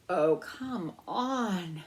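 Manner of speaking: drawn-out slow whine; impatient pleading. A narrator's reading. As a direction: stretch it out, exasperated. Ralph growing impatient.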